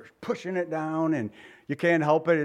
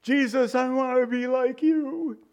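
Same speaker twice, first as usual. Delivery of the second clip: higher, slower pleading prayer; mock earnest prayer, raised high; 8 semitones higher than usual. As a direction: lift it up like a heartfelt plea. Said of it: "how many of us have prayed".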